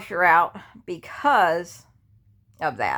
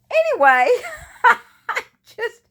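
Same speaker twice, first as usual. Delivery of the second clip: laughing breathlessly; 6 dB louder than usual. Real, not acted. Laughing at her own exhaustion.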